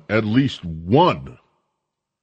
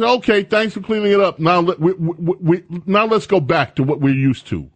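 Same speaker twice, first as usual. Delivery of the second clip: mocking quote; 7 semitones higher than usual. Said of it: "they said".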